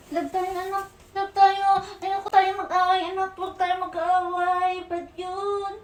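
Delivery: soft, high, sing-song cooing; tender cooing. Real, not acted. Mother makes up tenderly with baby. (malambing at mahinang boses na parang naghehele)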